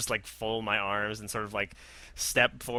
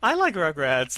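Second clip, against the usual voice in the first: higher-pitched imitation of wounded protest; mock hurt; 4 semitones higher, 4 dB louder, slower than usual. Friend teasing her about defending Rugrats, mock hurt. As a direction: tease with a put-on hurt, pitched up. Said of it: "you're really hurt at the assertion".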